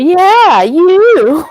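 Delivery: loud, high, swooping playful accusation; teasing exclamation. Real, not acted. Co-host answering the host's innocent "Me?", loudly teasing. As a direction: call them out, high and loud.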